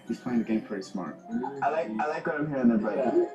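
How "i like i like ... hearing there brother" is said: normal talking voice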